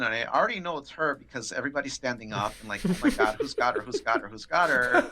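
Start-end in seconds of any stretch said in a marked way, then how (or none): 2.9-5.1 hammed-up panicky chatter; mock freaking out. Coworkers spotting the dreaded caller, mock panic. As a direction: imitate frantic coworkers, half laughing. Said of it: "everybody's freaking out".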